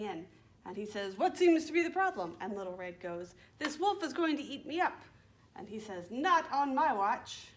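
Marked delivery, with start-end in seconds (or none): 1.2-2.4 loud, high, quick storybook rescuer; woodcutter to the rescue. Acted. Woodcutter rushing in to help. 3.6-5.0 lightly voiced girl calling for help, higher; mock-panicked storybook plea; alarmed Little Red. 6.1-7.5 slow, louder, higher storybook hero; heroic woodcutter. Acted.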